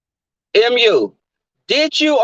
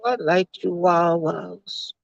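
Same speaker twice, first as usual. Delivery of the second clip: slow, soft airy nonsense; spacey dodge; 5 semitones lower, 5 dB quieter, slower than usual. Retold or imitated. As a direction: zone out and say it dreamily. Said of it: "She just like".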